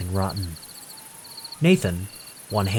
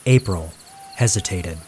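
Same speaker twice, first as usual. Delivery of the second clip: plain reading voice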